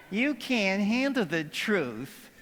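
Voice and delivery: soft, slow and lifeless; the wrong, limp way. Shown as a demonstration. Mock-bad take of the Nicholson line, soft and slow. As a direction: deliver it deflated, the way it wasn't said. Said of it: "That's not how he said it".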